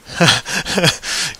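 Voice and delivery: short burst of real laughter; real chuckle after a glitch. Real, not acted. Laughs after the video blanked out.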